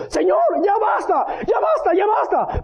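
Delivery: high, shouted plea; acted desperate cry. Preacher as David begging God during the plague, desperate shout.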